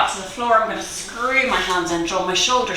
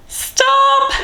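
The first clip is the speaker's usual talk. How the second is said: much higher, louder called "Stop!"; shouted stop; 5 dB louder than usual. Real, not acted. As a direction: call time to the whole class. Instructor calling end of exercise.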